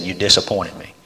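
soft, heavy words from a let-down dad; hushed letdown; quiet disappointed father. Retold or imitated. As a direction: say it low and hurt. Father quietly rebuking son.

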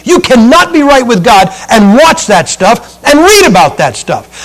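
loud, driving pulpit delivery; fiery preaching. Acted. Preacher warning his flock about vampire books, fiery. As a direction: hammer it home to the congregation.

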